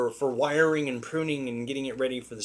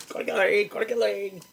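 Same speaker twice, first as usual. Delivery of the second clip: higher-pitched, silly little chicken lady voice; chicken lady impersonation; 7 semitones higher than usual. Retold or imitated. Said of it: "it's my impersonation".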